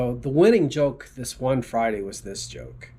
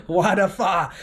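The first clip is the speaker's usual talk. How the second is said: higher, louder amused repeat; 6 semitones higher, 4 dB louder than usual. Real, not acted. Repeating the funny restaurant name to viewers, amused. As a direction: relish the silly name.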